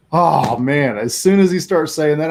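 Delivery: ordinary delivery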